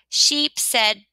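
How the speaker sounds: usual speaking voice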